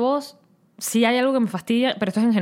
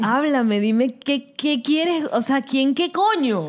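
higher, slowed mock demand; hammed-up frustrated "dime qué"; mock exasperation; 5 semitones higher, much slower than usual. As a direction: play the exasperated friend pleading for clarity. Mocking the confusing reply.

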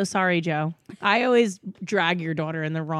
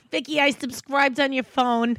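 mock Brooklyn mom, pitched up; mother impression; 4 semitones higher than usual. Daughter imitating mom announcing the subscription, higher motherly voice. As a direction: imitate mom proudly taking charge. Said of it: "I love when [name] does my voice".

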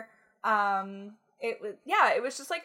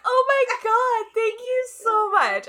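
much higher, louder and slow, gushing with embarrassment; flustered gushing; 13 semitones higher, 7 dB louder, slower than usual. Retold or imitated. Thanking the fan in the bathroom, squeaky flustered gush. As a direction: melt into a squeaky, starstruck thank-you. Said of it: "I really sounded like such an idiot".

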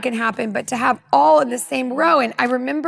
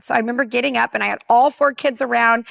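ordinary talk

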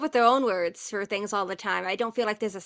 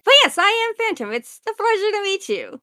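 grand introduction; 11 semitones higher, 7 dB louder than usual. Acted. Phantom introduces with a bow.

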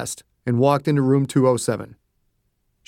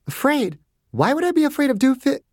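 high, dismissive scoff; doctor scoffing at being afraid; scoffing Margaret; 11 semitones higher than usual. A narrator's reading. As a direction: brush off the idea with a light laugh in the voice.

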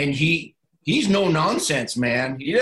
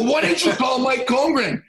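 exasperated retold yell; 9 semitones higher, 4 dB louder than usual. Questioning jailed player.

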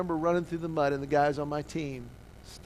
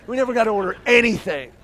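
loud, raised, insistent delivery; higher, forceful exclamation; emphatic repeat; 5 semitones higher, 9 dB louder, faster than usual. Real, not acted. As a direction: hammer it home to the crowd.

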